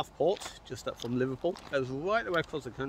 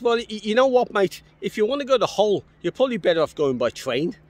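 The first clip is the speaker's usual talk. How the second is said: imitating a helpful passer-by, higher and louder; insistent local man; 9 semitones higher, 7 dB louder than usual. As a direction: press the advice on a stranger, raised voice. Acts out local man's repeated advice.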